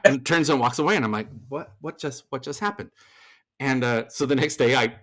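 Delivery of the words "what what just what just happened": stunned, softer stammer; mock dazed confusion, trailing soft; acting out being caught off guard; retold bewilderment. Stunned reaction to abrupt exit.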